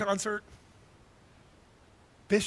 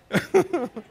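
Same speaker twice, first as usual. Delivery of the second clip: delighted laugh; 10 semitones higher, 8 dB louder than usual. Real, not acted. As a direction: laugh with real joy at his daughter. Playing with baby daughter.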